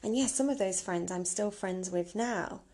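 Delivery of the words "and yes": normal talking voice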